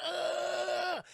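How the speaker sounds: high, drawn-out "ah"; acted yell while shooting; battle yell. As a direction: sustain a high mock war cry. Imitates detectives yelling while shooting.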